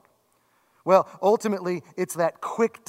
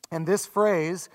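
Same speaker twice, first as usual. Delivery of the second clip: ordinary delivery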